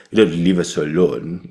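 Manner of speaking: mocking imitation of pouty overacting; trumpeting the words. Teacher mocks the student's line, mouthing it like a trumpet. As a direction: mimic the student's overblown mouthing. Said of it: "you're not playing a fucking trumpet".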